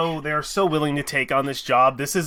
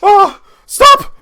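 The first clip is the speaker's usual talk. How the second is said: gasp, then a loud high shriek to stop; very high, breath-caught astonishment; astonished gasping; 12 semitones higher, 12 dB louder, much slower than usual. Acted. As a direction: cry out loudly in shock. Wife gasps at the writ.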